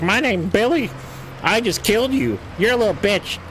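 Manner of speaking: put-on high, squeaky six-year-old taunting; mock a little kid trash talking on voice chat; bratty kid voice. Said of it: "the six-year-old that's on voice".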